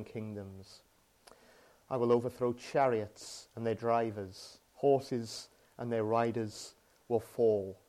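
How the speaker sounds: measured, solemn Bible reading; scripture reading. Acted. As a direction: read the prophecy steadily, letting each line land. Reads Haggai scripture aloud.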